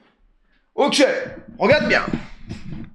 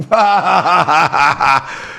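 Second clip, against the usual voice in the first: hearty open laugh; long burst of laughter; 3 semitones lower than usual. Real, not acted. Laughing at the false story. (grand éclat de rire)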